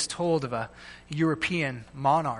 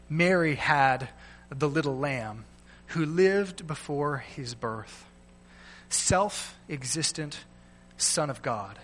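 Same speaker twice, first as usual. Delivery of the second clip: slow, measured poem reading, fading softer; poem recital; 3 semitones lower, 4 dB quieter, slower than usual. Acted. Pastor closing sermon with a poem, slow soft recital. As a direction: recite each line gently and deliberately.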